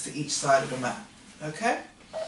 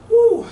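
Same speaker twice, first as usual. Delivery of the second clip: sharp high whoop of effort; 13 dB louder than usual. Real, not acted. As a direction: let out a loud strained woo. Exercise strain mid-crunch.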